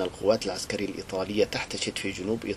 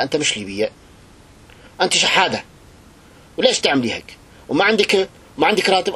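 shouting down at her, louder and higher; harsh tyrant voice; 8 semitones higher, 7 dB louder than usual. Retold or imitated. Tyrant sneering at a poor Libyan woman, loud mocking shout. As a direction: bark like a contemptuous dictator. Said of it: "قال لها".

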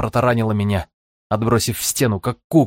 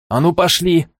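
higher, louder urgent shout; 8 semitones higher, 3 dB louder than usual. Acted. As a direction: yell them forward. Urging through collapse.

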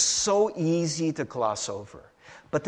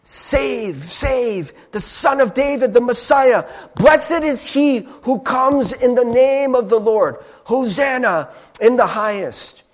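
crowd acclamation; 7 semitones higher, 7 dB louder than usual. Retold or imitated.

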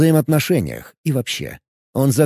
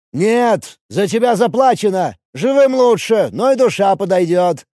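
shouting priest; 7 semitones higher than usual. Acted. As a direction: bark the order down at a cornered enemy. Dark priest shouting back.

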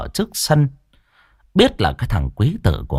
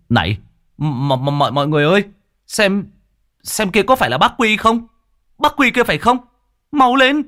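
alarmed calling out; 12 semitones higher, faster than usual. Acted. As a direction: call the others over in a panic. Villager calling out, finding Quy.

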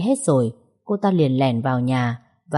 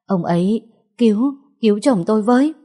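higher, faster, stammering plea through tears; crying plea; 6 semitones higher, 4 dB louder than usual. Acted. Wife pointing neighbours to her husband, crying stammer. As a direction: beg the neighbours, half sobbing. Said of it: "Bà Hoan vừa khóc".

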